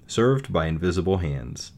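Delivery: ordinary delivery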